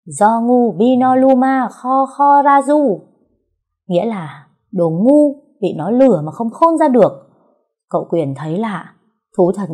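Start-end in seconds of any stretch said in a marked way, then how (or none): 0.0-3.0 high, very slow, loud fake spell; booming drawn-out magic chant; mock incantation. A narrator's reading. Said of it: "nhìn chằm chằm vào mắt cậu mà lên tiếng". 4.7-7.1 louder, higher scolding; mocking loud jab; loud insult. Translating insult at husband.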